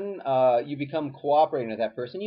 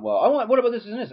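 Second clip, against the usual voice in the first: pushy demand; 9 semitones higher, 5 dB louder, faster than usual. Pretend. Demo of a pushy demanding person.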